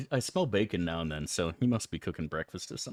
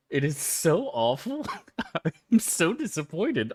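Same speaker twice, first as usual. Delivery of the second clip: high and laughing through the complaint; laughing disgust; 7 semitones higher than usual. Real, not acted. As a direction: laugh in disbelief at how bad it is. Laughing disgust at bad donuts.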